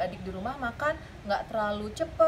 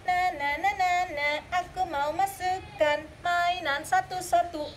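put-on slow, playful little boy; sing-song kid showing off his toys; a light child voice, slow; 5 semitones higher than usual. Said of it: "Lalu David bilang".

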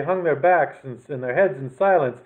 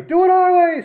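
high, loud cat twins; chirpy twin kittens insisting; twins' voice; 8 dB louder than usual. A narrator's reading. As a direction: squeak it out high and bossy. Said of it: "said the twins".

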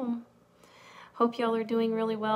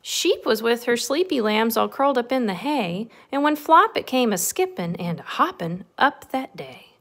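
lilting, animated read-aloud; bouncy storybook narration; story reading to children; 3 semitones higher, 9 dB louder than usual. A narrator's reading. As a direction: read a rhyming picture book to little ones. Reads picture book to children.

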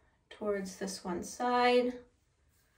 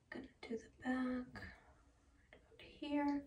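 breathy whisper to herself; 4 semitones higher, 11 dB quieter than usual. Real, not acted. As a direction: whisper it under your breath. Sectioning hair, whispered aside.

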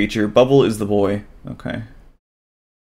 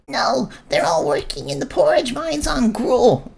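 nervous squeaky creature; 14 semitones higher than usual. Acted. Blue creature introduces itself to Bubble, high and timid.

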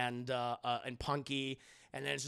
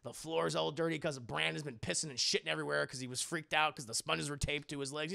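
usual speaking voice

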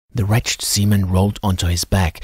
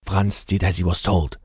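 ordinary delivery

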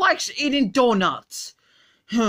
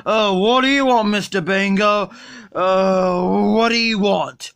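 put-on low slurred drunk voice; sluggish, drunk and lazy; Homer Simpson; 3 semitones lower than usual. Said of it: "Look, Homer".

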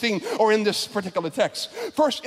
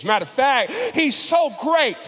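full-force preaching; 4 semitones higher than usual. Acted. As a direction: build it up from the pulpit.

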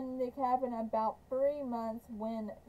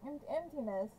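ordinary delivery

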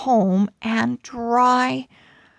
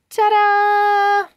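high, loud showy reveal; excited ta-da; 12 semitones higher, 5 dB louder than usual. Acted. Pug reveals her outfit, bursting with excitement. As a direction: present the outfit with a flourish.

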